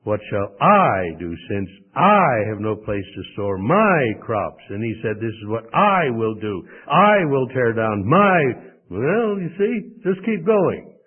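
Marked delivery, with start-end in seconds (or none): 0.0-4.6 "I" and "my" punched loud; stress on the pronouns. Shown as a demonstration. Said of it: "you could emphasize them". 5.2-8.7 loud, drawn-out "I" and "my"; stress on the pronouns. Shown as a demonstration. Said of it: "you could emphasize them".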